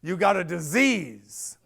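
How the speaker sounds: put-on loud "Boy" then slow, weighty verdict; stern doctor. Head doctor telling a young addict the truth, stern and slow. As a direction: lay down the diagnosis like a stern elder. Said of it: "He said".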